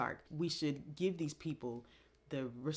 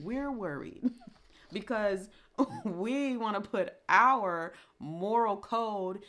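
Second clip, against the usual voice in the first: laughing through the words, higher; 6 semitones higher, 6 dB louder, slower than usual. Real, not acted. Vlogger laughs at fans judging the rapper. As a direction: laugh at how worried we get.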